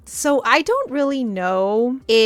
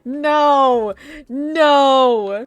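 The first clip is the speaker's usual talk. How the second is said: loud, drawn-out, higher dismay; emphatic refusal; 5 semitones higher, 5 dB louder, much slower than usual. Real, not acted. Reader to the book in dismay, emphatic refusal. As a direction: refuse it loudly, each no stretched. Refusing what the story makes her imagine.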